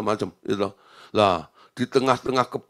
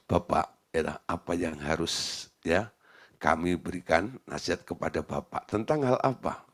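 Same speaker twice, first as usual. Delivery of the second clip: gentle probing question; 8 semitones lower, 8 dB quieter than usual. Retold or imitated. Pastor gently prompting the director to tell his story, softly. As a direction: coax him gently to open up.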